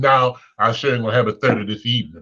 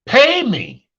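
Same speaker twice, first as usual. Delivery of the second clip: high, loud mock yell; loud demand; 11 semitones higher, 4 dB louder than usual. Comedian acting a lender yelling at a debtor to pay up. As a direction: bark it at the debtor.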